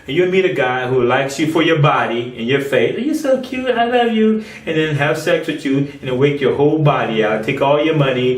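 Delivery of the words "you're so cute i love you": mock sweet-talker. Mocking a flattering suitor.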